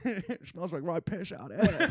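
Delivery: slow Cockney character voice with a hint of movie villain; laughing attempt at a Cockney Bane; Tom Hardy. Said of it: "but with a Cockney accent".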